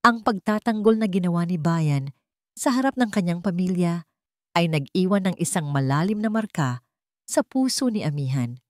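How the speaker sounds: usual speaking voice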